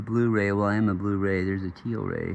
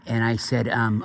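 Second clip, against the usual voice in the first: ordinary talk, nothing marked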